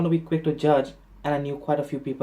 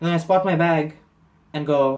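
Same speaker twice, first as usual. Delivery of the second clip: usual speaking voice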